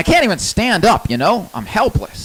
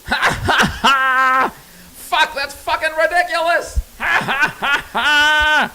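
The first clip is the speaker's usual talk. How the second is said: laughing; 5 semitones higher, slower than usual. Real, not acted. Laughing at Koch brothers' greed.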